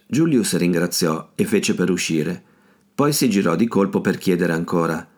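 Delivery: usual speaking voice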